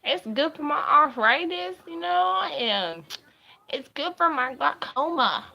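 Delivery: put-on high, loud old lady voice; grandma voice. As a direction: squeak it out like a granny. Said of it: "everybody's around smoking on their pens like this".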